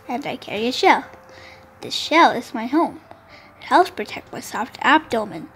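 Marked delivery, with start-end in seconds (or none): none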